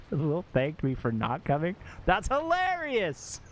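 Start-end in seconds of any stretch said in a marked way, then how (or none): 2.0-3.4 high, drawn-out burst of delight; delighted exclamation. Real, not acted. Streamer reacting to a viewer's funny story, delighted. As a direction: exclaim it high and slow, tickled.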